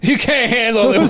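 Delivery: high, laughing mock challenge; mock bar guy. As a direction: tease like a swaggering barfly. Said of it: "acted bar guy quote".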